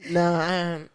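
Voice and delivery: normal talking voice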